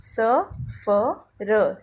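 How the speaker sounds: सफ़र drawn out very slowly with f, not ph; slow teaching demo of सफ़र with f; slow sounded-out word. Shown as a demonstration. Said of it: "जैसे मैंने अभी आपको पढ़ के बताया".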